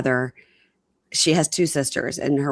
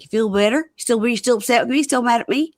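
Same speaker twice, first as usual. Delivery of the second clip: higher-pitched mock husband asking; quick, raised-pitch retelling of his questions; anxious husband imitation; 7 semitones higher, faster than usual. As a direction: play him checking in, pitched up. Voicing the husband checking in.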